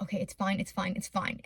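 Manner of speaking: soft self-reassurance. Retold or imitated. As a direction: murmur it to steady your nerves. Calming herself while lost.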